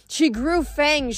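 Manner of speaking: ordinary delivery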